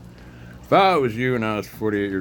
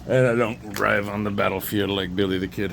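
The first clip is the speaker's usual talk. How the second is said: ordinary talk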